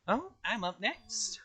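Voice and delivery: high, slow cartoon bear voice; gleeful squeaky mascot, slowly; Monokuma voice. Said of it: "I'd like to ask Monokuma".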